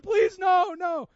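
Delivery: high, loud pleading cry; loud mock begging; begging shout. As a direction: shout as if tapping out. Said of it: "You're like".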